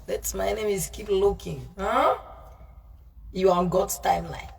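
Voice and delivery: normal talking voice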